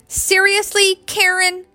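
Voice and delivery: high-pitched incredulous question; disbelieving demand. A narrator's reading. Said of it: "in disbelief, Joey demanded".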